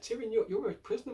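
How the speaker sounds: a touch of exasperated mockery; incredulous scolding. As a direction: talk down to him like he's mad. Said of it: "I'm like".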